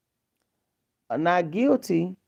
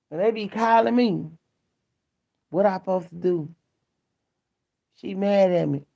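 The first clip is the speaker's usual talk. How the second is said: ordinary talk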